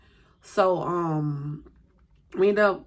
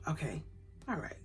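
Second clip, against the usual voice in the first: calmed; 14 dB quieter than usual. Retold or imitated. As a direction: let it go softly. Calmed by sister.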